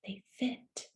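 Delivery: a soft breathy whisper of delight. A narrator's reading. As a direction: hush it like a secret. Hushed reveal in storybook.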